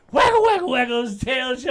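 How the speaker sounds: high, loud excited sing-song; excited waggle. Retold or imitated. Describing the friendly pit bull, loud high excitement. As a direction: bounce the words like a wagging tail. Imitating the dog's wagging tail.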